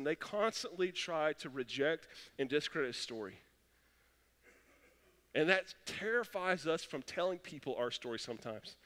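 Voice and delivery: ordinary delivery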